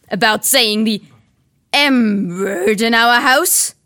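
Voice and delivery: loud, slow, drawn-out fury; furious. Acted. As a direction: lean hard on each word. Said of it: "thundered his uncle".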